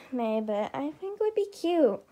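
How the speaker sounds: ordinary delivery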